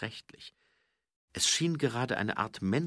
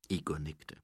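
usual speaking voice

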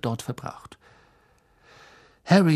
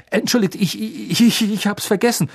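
hasty stammer; 8 semitones higher, 4 dB louder than usual. Acted. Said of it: "sagte Harry hastig".

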